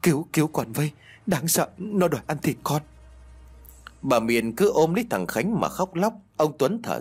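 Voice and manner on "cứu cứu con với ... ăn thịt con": put-on higher, shaky-tongued stammer of a scared boy; stammering in fear. A narrator's reading. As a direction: stumble over the words, terrified. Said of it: "Nó lắp bắp nói".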